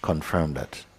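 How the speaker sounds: soft, unhurried meditation guidance; guided contemplation. Acted. Teacher guiding students into contemplation, soft and unhurried. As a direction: guide them gently into stillness.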